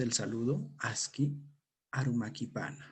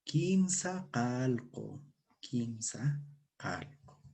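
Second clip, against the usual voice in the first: slow model; much slower than usual. Shown as a demonstration. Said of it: "Repitan conmigo".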